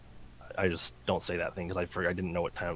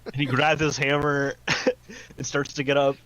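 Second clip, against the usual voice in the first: loud, laughing through the words; cracking up while telling it; 8 semitones higher, 9 dB louder than usual. Real, not acted. Laughing at his own joke.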